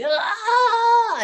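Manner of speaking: sharp, high pained yell; anguished cry. Retold or imitated. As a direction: let out a strangled cry. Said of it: "I just hear him going".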